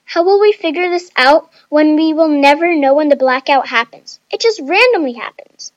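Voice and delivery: loud, fretful complaint; exasperated, whiny protest; whining. A narrator's reading. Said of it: "Chloe whined".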